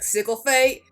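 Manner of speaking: loudly mangled French, self-mocking; mock clumsy pronunciation. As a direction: blurt out "Sickle fate" like butchered French. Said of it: "and I'm like".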